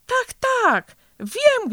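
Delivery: loud, high-pitched excited realisation; thrilled cry of remembering; overjoyed. A narrator's reading. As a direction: burst out with it, high and delighted. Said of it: "zawołał uradowany".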